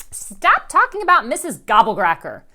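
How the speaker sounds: loud, sharp shout; scream. A narrator's reading. Violet screams at Rascal, loud angry shout. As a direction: yell it at her sibling. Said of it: "screams Violet".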